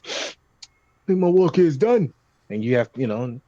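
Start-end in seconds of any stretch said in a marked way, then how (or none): 0.0-2.1 triumphant character line. Acted. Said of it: "and it says".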